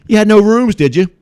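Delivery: high, very loud gloating shout; hammed-up screaming taunt; triumphant yelling. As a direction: yell it in their face, acting out the confrontation. Storyteller confronting hotel staff, yelled triumphantly.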